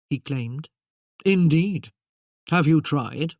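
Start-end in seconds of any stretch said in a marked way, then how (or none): 1.2-3.3 very dry, louder retort; drily sceptical put-down. Acted. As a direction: cut him down with dry scepticism. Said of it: "The Duke's tone was very dry".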